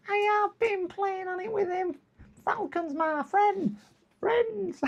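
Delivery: very high put-on squeaky character; squeaky Worms voice. Chat regular claiming his gaming friend, imitated in high squeak. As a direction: speak as a tiny, silly, squeaking friend. Answers as Worms about Falcon.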